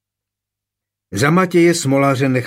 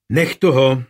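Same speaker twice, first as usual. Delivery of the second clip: louder, sharp scolding; sharp rebuke; 5 dB louder than usual. A narrator's reading. Kuneš rebukes his wife Adléta, sharply. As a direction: cut her off firmly. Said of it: "napomenul jí ostře Kuneš".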